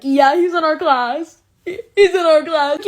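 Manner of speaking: high, very loud mock chorus of classmates; loud, high-pitched imitation of backing someone up; classmates playing along. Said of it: "everyone wants to play along".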